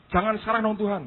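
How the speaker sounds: high, pleading bargaining voice; Yusak bargaining. Retold or imitated. Young Yusak begging God to wait, high and pleading. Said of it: "Terus dia berkata".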